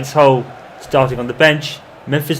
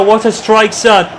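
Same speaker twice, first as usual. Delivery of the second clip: loud, raised cry of praise; excited goal call; 7 semitones higher, 5 dB louder, slower than usual. Acted. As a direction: hail the scorer at full voice. Goal call in live commentary.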